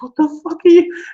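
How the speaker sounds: mock high, loud outcry; horrified disbelief. Prey confronts the creature eating him, mock horrified disbelief. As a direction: exclaim it way up high, as the stunned prey.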